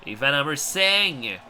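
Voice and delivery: lively wrestling play-by-play; wrestling commentator. Acted. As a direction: call the match live.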